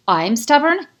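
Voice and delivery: high, loud incredulous echo; outraged disbelief; indignant disbelief. A narrator's reading. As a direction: throw his word back at him. She repeats his accusation indignantly.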